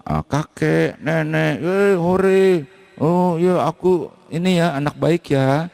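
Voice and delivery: put-on high, giddy sing-song of a proud child; gleeful dead soul. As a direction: gush like a good kid reunited with grandparents. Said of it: "kau pikir kan pasti dipuji-puji dong". (suara tinggi riang seperti anak bangga)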